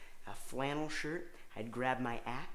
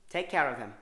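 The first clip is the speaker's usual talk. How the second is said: loud mother voice; 6 dB louder than usual. Pretend. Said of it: "do that kind of loud".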